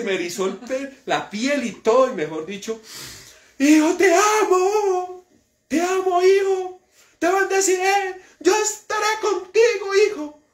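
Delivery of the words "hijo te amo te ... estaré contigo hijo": very high, slow syrupy prophet voice; a preacher mocking a fake prophet's tender voice; mocking false prophet. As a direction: ham up sweet divine words. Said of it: "el profeta".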